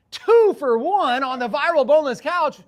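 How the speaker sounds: imitating a coworker's hyped couch promo; salesy pitch. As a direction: sell it like a quick video ad. Brian's quick sales video pitch.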